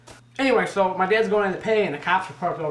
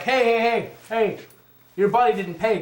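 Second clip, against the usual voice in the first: raised voice calling after him; retold calling out; 5 semitones higher, 4 dB louder than usual. Restaurant staff calling a customer back, loud.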